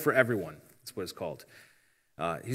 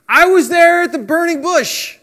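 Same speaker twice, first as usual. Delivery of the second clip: very high, loud mock shout; hammed-up high-pitched exclamation; dramatic proclamation; 11 dB louder than usual. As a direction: cry it out like a grand, absurd announcement. Mock dramatic claim voiced for Jesus.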